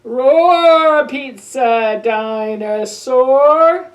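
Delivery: very loud, slow roaring; dinosaur roar. Acted. Reading aloud to kids, very loud slow roar.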